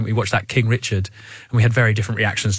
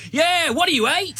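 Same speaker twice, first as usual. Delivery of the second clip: high-pitched mock heckle; passive-aggressive shouting. Spectator avoiding confronting a bigger dad, passive-aggressive high heckle. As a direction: call out a petty jibe, pitched way up. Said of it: "passive-aggressive shouting".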